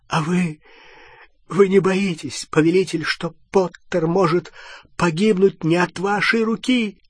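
high, fearful servant voice; trembling voice. A narrator's reading. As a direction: timidly question your master, pitched high. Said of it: "Голос Малфоя дрожал". (дрожащий, испуганный высокий голос)

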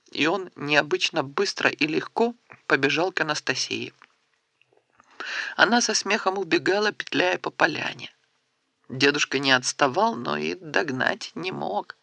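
plain reading voice

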